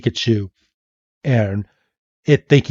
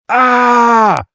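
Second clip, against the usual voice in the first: high cartoon shocked scream; hammed-up high 'ah'; mock scream; 11 semitones higher than usual. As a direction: yelp as if zapped, held out. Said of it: "And Red's like".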